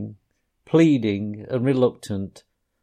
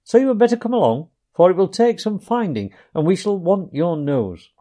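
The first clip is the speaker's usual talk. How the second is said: brisk cheer; 7 semitones higher, 5 dB louder than usual. A narrator's reading. Rat cheerfully urging Mole.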